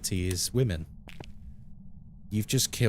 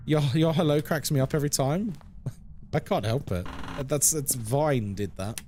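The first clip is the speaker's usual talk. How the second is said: normal talking voice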